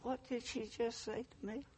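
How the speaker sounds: high, hushed disbelief; incredulous inner thought. Retold or imitated. New husband to himself after being called silly, hushed disbelief. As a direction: think it aloud, very soft and high. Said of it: "I thought".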